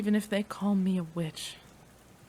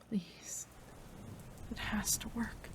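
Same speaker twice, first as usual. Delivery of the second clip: whispered, urgent pleading; anxious whisper; 3 semitones higher, 11 dB quieter than usual. Acted. Scientist waiting over her lover's body, anxious whisper. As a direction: whisper it like a prayer you barely dare say. Desperate wait for the serum.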